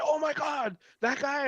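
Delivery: high-pitched mock exclamation of sudden recognition; imitated excited recognition. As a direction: act out someone suddenly remembering, voice shooting up. Acting out others' delighted recognition.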